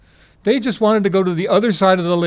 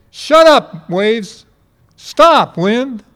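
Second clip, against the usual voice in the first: loud, high order to the waves; a command that stills the sea; commanding the storm; 5 semitones higher, 8 dB louder, much slower than usual. Retold or imitated. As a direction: bark it at the wind. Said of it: "waking up going".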